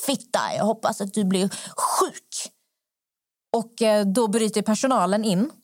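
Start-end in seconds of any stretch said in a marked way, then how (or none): 0.1-2.5 imitated angry old man. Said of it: "Och han bara".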